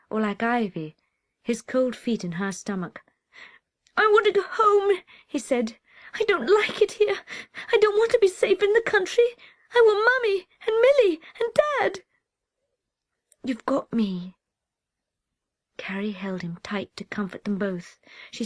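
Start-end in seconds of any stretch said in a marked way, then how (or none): 4.0-12.0 put-on high, loud pleading child voice; a small boy begging to go home; much higher tearful plea; homesick little boy. 13.4-14.4 comforting whisper. Acted. Carrie comforts brother in the dark.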